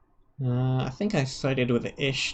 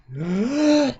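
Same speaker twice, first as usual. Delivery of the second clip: gasp; 12 semitones higher, 5 dB louder than usual. Acted. Mind link breaks suddenly.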